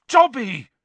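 loud, high startled yell. A narrator's reading. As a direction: yell the name in shock. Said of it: "Harry yelled".

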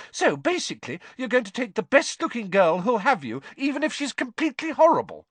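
high-pitched indignant accusation, climbing; bristling. A narrator's reading. Hermione accusing Ron of shallowness, bristling. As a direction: build to an outraged high finish.

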